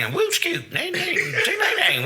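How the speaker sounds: mock the old wrestling trainer in a tiny high voice; impression of old trainer. Old trainer answering a wannabe wrestler, squeaky high mock voice. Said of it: "that's my impression of him".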